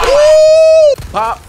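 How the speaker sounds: high, loud victory yell; excited whoop. Real, not acted. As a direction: celebrate like crazy. Big hit in game.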